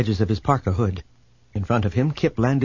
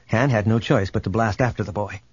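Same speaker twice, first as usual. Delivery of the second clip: usual speaking voice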